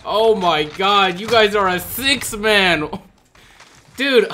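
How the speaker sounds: high, loud, stretched-out exclamation; freaking out. Real, not acted. Streamer freaking out at a full enemy party, loud and high. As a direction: lose it in disbelief. Freaking out over six man.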